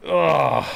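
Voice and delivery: long, drawn-out groan of disgust. Real, not acted. Man hating on a rock singer, disgusted groan. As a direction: groan with real loathing.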